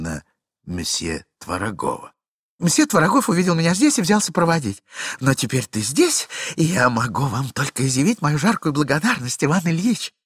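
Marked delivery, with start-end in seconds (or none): 2.6-10.1 chattering lady. Acted. Lady continues to husband.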